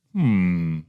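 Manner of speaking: ordinary talk, nothing marked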